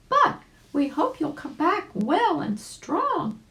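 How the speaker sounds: put-on high, slow little girl voice with a laugh; sweet little rabbit wishing him well. Susie wishing her uncle a safe trip, high and sweet.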